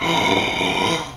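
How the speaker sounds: mock gasp. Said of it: "you're like".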